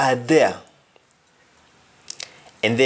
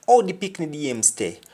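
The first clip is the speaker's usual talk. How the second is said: ordinary delivery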